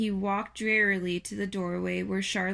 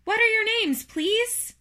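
high, loud, eager question; thrilled character voice, raised high; trembling with joy; 13 semitones higher, 6 dB louder than usual. A narrator's reading. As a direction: ask in overjoyed excitement, pitched way up. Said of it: "trembling with joy".

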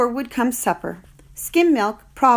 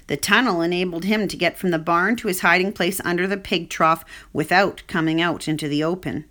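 ordinary delivery

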